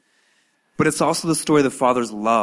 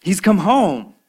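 higher, loud, fast excited babble; excited servant; 7 semitones higher, 6 dB louder than usual. Acted. Servant telling the elder brother about the party, excited and high. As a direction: gush the news, high and quick. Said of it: "And the servant says".

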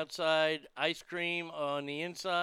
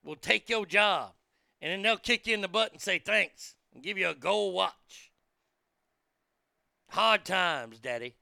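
Dusty Rhodes promo; 2 semitones higher, 6 dB louder than usual. Retold or imitated. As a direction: preach it like a wrestler on the mic. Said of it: "that's how he said it".

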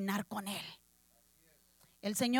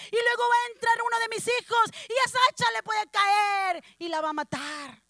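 put-on frantic girl; 5 semitones higher, 3 dB louder than usual. Anxious girl's frantic reasoning.

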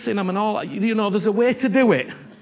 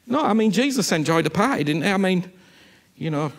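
usual speaking voice

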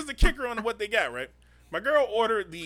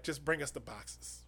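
quiet firm request; 8 semitones lower, 14 dB quieter than usual. Retold or imitated. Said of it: "And she's like".

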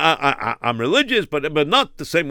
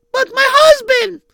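squeaky high-pitched woman; high woman's voice; 4 dB louder than usual. Acted.

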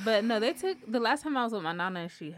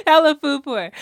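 laughing; 7 semitones higher, 13 dB louder than usual. Real, not acted. Laughing at herself.

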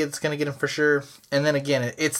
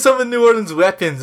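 ordinary talk

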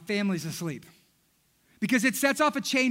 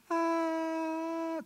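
put-on angelic baby cry; 8 semitones higher, 4 dB quieter than usual. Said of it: "crying, right?".

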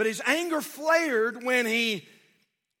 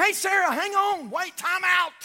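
higher, louder interjection; urgent calling out; 7 semitones higher, 4 dB louder than usual. Acted. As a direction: call out to halt someone rashly. Imagined calling out to stop Sarah.

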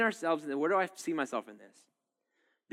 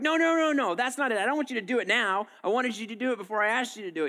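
high, loud "no, no, no", then a fast rant; exasperated spouse; 7 semitones higher, 3 dB louder than usual. Acted. Spouse refusing help with the dishes, exasperated and high. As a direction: cut them off, fed up. Acted spouse quarrel, rejecting.

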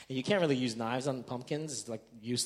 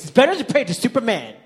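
very loud, high mock shout; hammed-up kid's tirade at full volume; in-your-face yelling; 10 semitones higher, 15 dB louder than usual. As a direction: imitate a child yelling in dad's face. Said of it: "Like, in my face".

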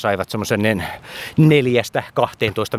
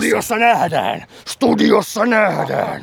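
defiant challenge; 9 semitones higher, slower than usual. Pretend.

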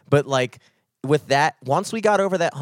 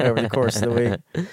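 voice cracking; 2 semitones lower than usual. Real, not acted. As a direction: let the voice break upward. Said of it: "my voice just cracked".

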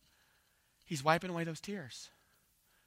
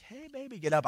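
gracious parent; 9 semitones higher than usual. Acted. Gracious parent waking a kid, tender and high. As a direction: coax a child gently out of sleep. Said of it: "maybe you're gracious to your kids".

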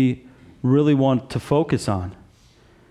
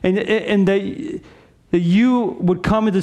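higher-pitched, pleading sermon delivery; impassioned preaching; 6 semitones higher than usual. Real, not acted. Preacher appeals to listeners who never trusted Jesus, impassioned. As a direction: press the appeal on without letting up.

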